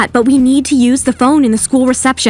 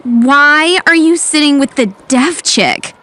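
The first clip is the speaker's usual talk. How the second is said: whiny voice. Acted. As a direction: look down on her, whining. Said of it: "I heard a whiny voice".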